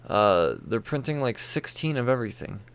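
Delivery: normal talking voice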